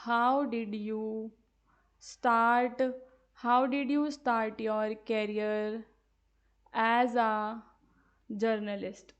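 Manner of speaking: very slow, each word set apart; slow dictation. Shown as a demonstration. Teacher dictates sample question.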